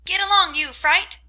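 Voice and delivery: high, loud parrot screech; parrot scream. A narrator's reading. Parrot insulting Amy, loud screech. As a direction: screech it at the girl. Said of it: "screamed Polly".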